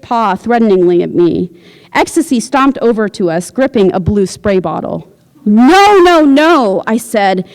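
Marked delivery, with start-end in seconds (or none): 5.5-6.8 loud, high no no no; loud protest. A narrator's reading. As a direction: shout to stop her. Protesting loudly.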